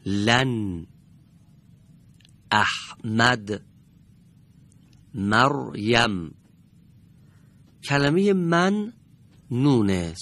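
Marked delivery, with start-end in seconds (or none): 2.5-3.6 احمد drawn out slowly, the ه held silent and joined to the opening vowel; احمد spoken slowly, syllable by syllable, as a Quran teacher; slow demo reading. Shown as a demonstration. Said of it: "به این کلمات دقت بفرمایید". 5.1-6.3 مریم very slow, the silent ر joined to the opening مَ; مریم spoken slowly for students to copy; slow demo reading. Shown as a demonstration. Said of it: "به این کلمات دقت بفرمایید".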